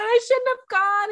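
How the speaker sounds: high, pleading and regretful; a lightly teary, high apology. Retold or imitated. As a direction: say it like you're near tears. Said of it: "I said".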